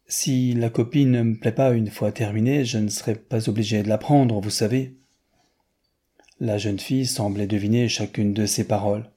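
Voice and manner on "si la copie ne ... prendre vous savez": ordinary delivery, nothing marked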